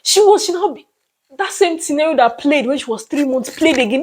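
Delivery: ordinary talk, nothing marked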